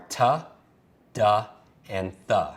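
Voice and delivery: "t, d, and th" as tuh, duh, thuh; letter sounds. Shown as a demonstration. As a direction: sound out "t, d, th" separately with a short uh. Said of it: "The sounds for number one are".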